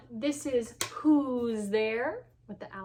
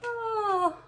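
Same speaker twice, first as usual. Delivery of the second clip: pained cry; 11 semitones higher, 3 dB louder than usual. Real, not acted. Pain from funny bone.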